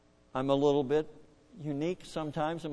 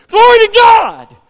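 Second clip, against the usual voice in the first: a huge, high, slow shout of glory; shouted praise; 24 dB louder, slower than usual. Retold or imitated. Dad rejoicing at the altar, shouting. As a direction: let it explode off the walls. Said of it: "and he goes".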